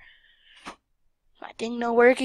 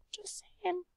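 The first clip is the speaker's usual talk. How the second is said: whispered aside; 7 semitones higher, 11 dB quieter than usual. Real, not acted. Aside after Hawaiian shirt remark.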